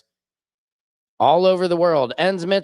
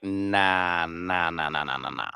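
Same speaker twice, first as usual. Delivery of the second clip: fading dismissive nahs; 8 semitones lower, 6 dB quieter than usual. Real, not acted. Dismissing a Trump supporter.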